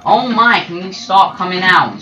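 loud, high fed-up cry; annoyed outburst at hiding players; exasperated yell. Real, not acted. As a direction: shout at the campers. Exasperated at enemies popping out.

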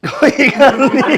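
laughing. Real, not acted. Laughing breaks the take.

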